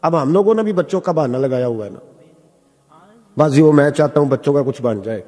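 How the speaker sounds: ordinary talk, nothing marked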